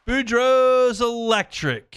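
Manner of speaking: high, loud, drawn-out sponsor call; announcer-style shout. Pretend. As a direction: belt out the brand name, stretching it slowly. Host calls out sponsor's name.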